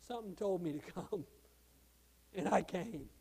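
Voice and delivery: soft, low retold reply; understated, softly spoken answer; quiet simple answer. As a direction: drop to a hushed, low testimony. Retold humble answer of revival-goers.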